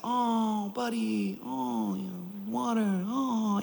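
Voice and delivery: put-on soft, hushed soothing of a crying baby; coddling. Dad retelling how he coddled his upset son, soft and soothing. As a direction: coo at him gently to comfort. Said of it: "I coddled him".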